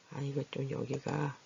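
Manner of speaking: usual speaking voice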